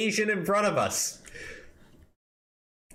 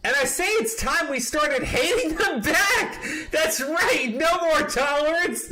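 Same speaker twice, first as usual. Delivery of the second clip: mock rally cry; 9 semitones higher than usual. Reading the manifesto aloud, hammed-up rally cry. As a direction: preach it like a mock demagogue.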